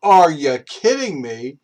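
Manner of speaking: can't believe he drove off; retold outrage; incredulous. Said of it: "I'm like".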